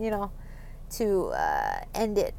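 quiet, uneasy laugh around the words; awkward laughing. Real, not acted. Podcaster admitting a dark, awkward topic to listeners, laughing nervously. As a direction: trail off softly into an uncomfortable laugh. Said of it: "I know I'm laughing".

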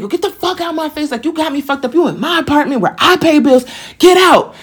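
high-pitched indignation, held back in volume; re-enacted angry order. Her to the man in her apartment, re-enacted angry order. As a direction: act out throwing someone out, building to the last words.

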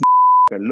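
loud, high whooping laugh; burst of laughter. Real, not acted. Cracking up at the punchline while telling the host, loud and high. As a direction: explode into a shrieking laugh.

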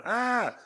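high mock pained yelp; imitated child's scream. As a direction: yelp like a hurt kid. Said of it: "he's going".

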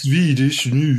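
"noo" drawn out in playful mock Swedish. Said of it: "We could do some Swedish noo".

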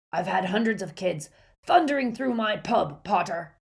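put-on loud gruff old barman; a cross old innkeeper scolding. Angry barman confronts Harry.

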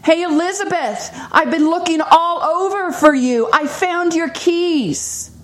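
high, excited calling out; retold shout. Old acquaintance calling over that he found her keys, high excited shout. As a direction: call across to her, thrilled to have found her. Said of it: "But he shouted again".

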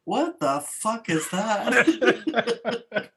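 drawn-out quote broken by laughter; sleepy laughing imitation. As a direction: echo the father's line while giggling. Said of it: "he was going".

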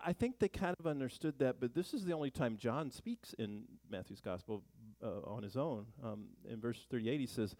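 ordinary delivery

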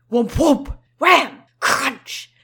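loud punchy whoomps and crunch; impact sound effects. Acted. Reader voices the cone hands striking, loud impacts. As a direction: slam each sound out.

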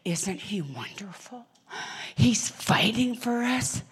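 slow, whispered mock admiration; hushed mocking praise; mock adoring. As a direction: breathe it like a starry-eyed fan. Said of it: "They go".

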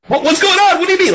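very high, flustered protest; mock alarmed squawk. Fan acting out the cartoon confrontation, high mock alarm.

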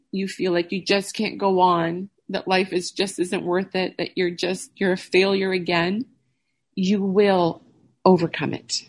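ordinary talk

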